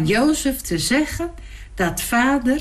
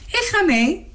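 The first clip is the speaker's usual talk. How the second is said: excited cry; 10 semitones higher than usual. Acted. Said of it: "riep hij".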